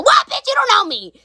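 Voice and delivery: sudden high-pitched mock outrage; indignant clap-back. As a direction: fire back as if insulted. Acted clap-back at the claim.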